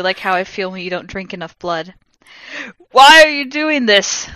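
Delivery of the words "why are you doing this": slow, loud, high angry demand; angrily. A narrator's reading. Lupin demanding an answer, loud and angry. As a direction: demand it hotly. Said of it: "Lupin said angrily".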